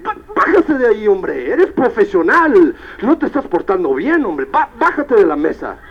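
loud, high, indignant telling-off; acted angry scolding. Fired-up engineer ordering professionals off the table, angry scolding. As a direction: scold them like misbehaving professionals, hammed up. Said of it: "enojado, yo queriendo pararme y decir".

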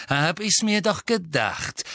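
high, knowing retort; scornful. A narrator's reading. Master seeing through the elf, scornful. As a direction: confirm the suspicion with disdain.

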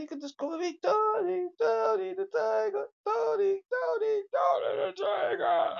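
high, loud roaring cartoon tiger shout; Tony the Tiger. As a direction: belt it like a cereal-box mascot. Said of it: "you was sounding like a tiger".

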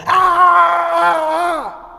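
high, loud explosive shout of rage; mock burst of pent-up anger; angry outburst yell. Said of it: "Then suddenly one day go".

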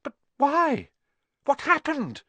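put-on high, pressing boy's questions; urgent. A narrator's reading. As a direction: plead urgently for an answer. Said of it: "Harry asked urgently".

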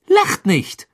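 irritated. Acted. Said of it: "sagte Ginny gereizt".

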